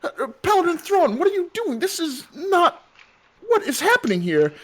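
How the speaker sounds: high, crying protest; sobbing out the questions; tearful outrage. Acted. Wife distraught, being taken away.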